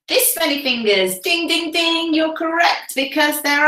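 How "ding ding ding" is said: ordinary delivery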